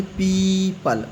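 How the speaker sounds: पीपल with a drawn-out 'ee'; silent o. Shown as a demonstration. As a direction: stretch the first syllable of पीपल, very slow. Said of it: "तो इसको क्या पढ़ेंगे?". (धीमे, पीपल में लंबी 'ई')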